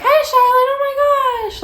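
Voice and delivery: high, gushing mock greeting; roommate in a good mood. Imitating the roommate's good-mood greeting, high and gushing. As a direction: squeal it like an overexcited friend. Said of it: "Or sometimes she's like".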